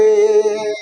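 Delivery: teacher holding the vowel ए long; drawn-out vowel demo. Shown as a demonstration. Teacher shows students a vowel can be held. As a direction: stretch out ए, ए, ए to show it never stops. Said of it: "हम कितनी देर भी बोलेंगे तो ए".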